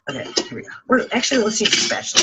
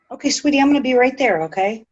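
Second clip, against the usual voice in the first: sweet call to the cat; 7 semitones higher than usual. Real, not acted. Calling to the cat.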